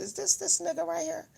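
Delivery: quiet double take; soft, surprised squint; hushed incredulous. Retold or imitated. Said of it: "I said".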